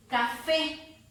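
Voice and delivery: Café with the force on FÉ; Café sounded out, FÉ held; stress on final syllable. Shown as a demonstration. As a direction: lean firmly into the last syllable of Café. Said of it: "aquí está".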